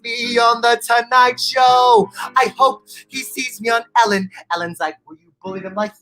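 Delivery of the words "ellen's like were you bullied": usual speaking voice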